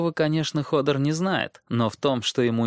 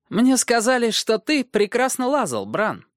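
sly dwarf voice; 12 semitones higher, 4 dB louder than usual. Acted. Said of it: "произнёс наконец человечек".

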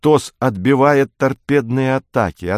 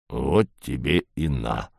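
low, slow letdown sigh of a line; deflated and slow; disappointed; 9 semitones lower, 6 dB quieter, slower than usual. A narrator's reading. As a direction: let the air out of it. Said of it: "разочарованно проговорил Двуглавый".

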